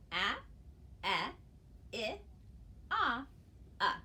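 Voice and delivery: "ah, eh, I, ah, uh" each short and clear; short vowel sounds. Shown as a demonstration. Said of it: "altogether it's".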